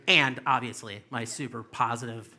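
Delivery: ordinary talk, nothing marked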